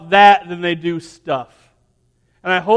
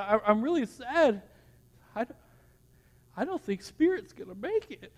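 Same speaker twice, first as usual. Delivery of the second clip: put-on soft, higher, halting little-girl voice; imitating crying child; 3 semitones higher, 13 dB quieter than usual. Daughter fearing Spirit won't make it, imitated tearful voice. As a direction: mimic a daughter quietly holding back tears. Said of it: "she's crying".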